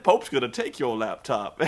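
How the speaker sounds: lightly imitated wrestler, low-pitched; Pope impression. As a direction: quote him in a slightly put-on low voice. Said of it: "he even said".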